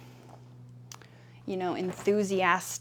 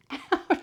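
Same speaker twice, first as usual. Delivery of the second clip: burst of laughter; 10 semitones higher, 6 dB louder than usual. Real, not acted. Sudden laugh at cat biting.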